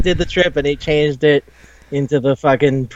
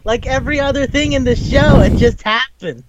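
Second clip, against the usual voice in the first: exasperated; 6 semitones higher than usual. Real, not acted. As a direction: throw up the point in comic exasperation. Exasperated by show's plot holes.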